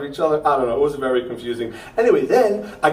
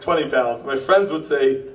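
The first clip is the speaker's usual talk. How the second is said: ordinary delivery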